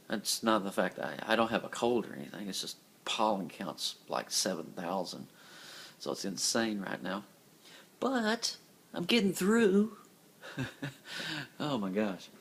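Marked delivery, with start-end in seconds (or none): none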